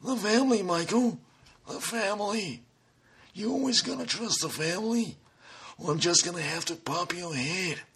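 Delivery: quiet menace of a godfather; mob boss. Shown as a demonstration. Mob boss threat scene.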